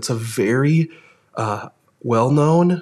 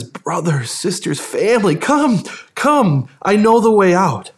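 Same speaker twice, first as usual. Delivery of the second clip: urgent calling out; 4 semitones higher than usual. Acted. Preacher as Jesus calling his family to the way out, urgent. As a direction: beckon them along, pitch climbing on "come".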